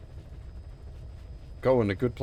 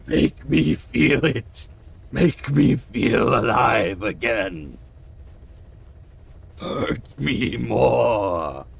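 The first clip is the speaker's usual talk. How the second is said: mock dramatic plea; 4 semitones lower, much slower than usual. Dramatic line after emotional mission.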